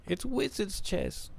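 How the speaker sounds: high, slow, dragged-out mock awe; Ron's line. Streamer imitating Ron's favourite line, high and slow. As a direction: stretch each word up high like a starstruck boy. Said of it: "I love that one".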